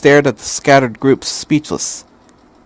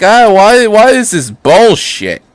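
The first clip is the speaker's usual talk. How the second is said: much higher and louder fed-up outburst; hammed-up annoyed viewer; parodying angry fans; mock exasperated fans; 9 semitones higher, 6 dB louder, slower than usual. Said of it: "people are just like".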